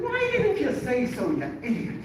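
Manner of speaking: high, loud scolding; exasperated Irish rabbi. Retold or imitated. Rabbi scolding the student for not correcting him, loud Irish voice. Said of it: "He said".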